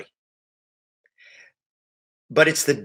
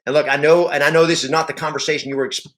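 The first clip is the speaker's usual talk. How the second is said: ordinary talk, nothing marked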